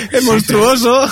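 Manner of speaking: exagerado entre risas; riendo. Real, not acted. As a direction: carcajada al decirlo. Risa tras la broma. (riendo a carcajadas)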